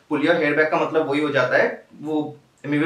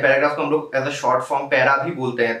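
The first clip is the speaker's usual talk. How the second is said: usual speaking voice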